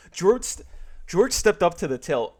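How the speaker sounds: bright, lively storybook narration; dramatic read-aloud. A narrator's reading. Reading Seinfeld fan fiction to viewers, animated narration. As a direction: read it like a sitcom fanfic.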